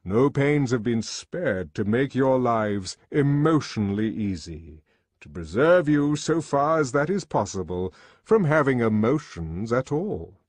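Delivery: grand, emphatic lecturer's voice; lecturing controller. A narrator's reading. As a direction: proclaim it to a class of boys.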